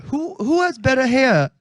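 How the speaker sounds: halting, put-on British accent; theatrical British, starting high and slowing. Shown as a demonstration. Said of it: "in his best British accent".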